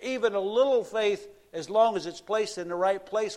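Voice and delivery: fervent sermon climax; preaching at full force. Acted. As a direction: drive the point home from the pulpit. Preaching passage raised pitch.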